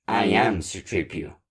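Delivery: android character voice; C-3PO. Acted. Android student introduces himself to Spanish class, in character voice.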